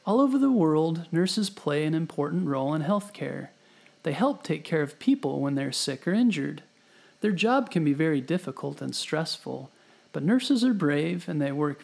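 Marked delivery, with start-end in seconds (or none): none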